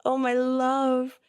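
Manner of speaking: high, sugary doting parent voice; mock gentle parent. Mocking a permissive parent offering cookies, high and doting. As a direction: coo like an overindulgent parent. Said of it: "we think".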